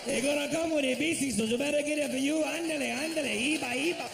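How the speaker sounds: put-on a cashier struggling with the order in a Spanish accent; hammed-up Spanish-accented cashier; mock Spanish accent. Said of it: "He said".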